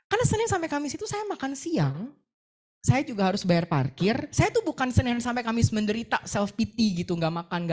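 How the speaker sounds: usual speaking voice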